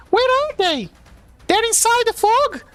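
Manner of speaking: very high, searching voice; high character voice. Acted. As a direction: call out looking around. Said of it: "And she says".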